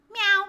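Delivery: high, loud cat meow; sudden short kitty yelp; playful meow. Pretend. Streamer meowing at a friend on call, playful.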